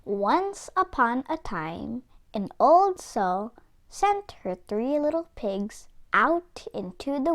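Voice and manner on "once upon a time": ordinary delivery, nothing marked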